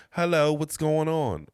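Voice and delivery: high, loud, drawn-out British greeting; British accent. Acted. Co-host pretending to be a British actor on set, exaggerated British accent. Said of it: "I'm about to do a British accent".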